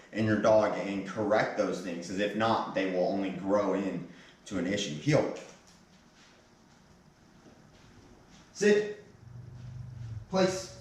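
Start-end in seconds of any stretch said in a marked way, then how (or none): 4.9-10.8 sharp commands. Real, not acted. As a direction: call each command out firmly. Trainer commanding dog.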